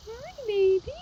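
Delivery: very high sing-song coo. Real, not acted. Greeting the horse softly.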